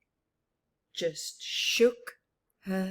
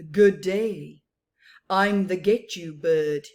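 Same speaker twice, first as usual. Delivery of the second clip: lower, louder sly predator; menacing Get You bird; 3 semitones lower, 10 dB louder than usual. Acted. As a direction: greet her like a hungry hunter. Predator bird introduces himself.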